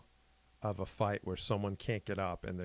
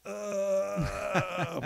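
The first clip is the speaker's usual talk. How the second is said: long, high drawn-out groan of pain; imitated pain noise; 10 semitones higher than usual. Retelling the noise a fighter made when hit, imitated pain groan. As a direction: mimic a fighter's high, sustained "uhhh". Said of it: "he made a noise like".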